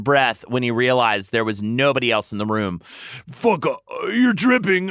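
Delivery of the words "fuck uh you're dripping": ordinary delivery, nothing marked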